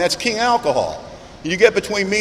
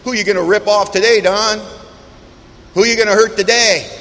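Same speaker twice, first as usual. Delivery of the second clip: louder, taunting questions hammered at himself; accusing inner voice; 4 semitones higher, 4 dB louder than usual. Retold or imitated. His own head mocking him, a louder accusing taunt. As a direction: jeer like the voice in your own head on a hangover morning. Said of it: "talked to me in my own voice".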